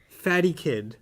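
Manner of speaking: normal talking voice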